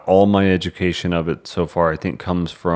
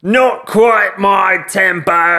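high, slow, exaggerated Cockney; hammy Cockney impression of a stern line; Cockney accent; 13 semitones higher, 5 dB louder, slower than usual. Shown as a demonstration. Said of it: "your best Cockney accent for me".

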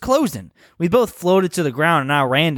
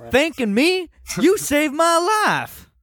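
hammy gushing hero; 10 semitones higher, slower than usual. Acted. Reading a hero's grateful line.

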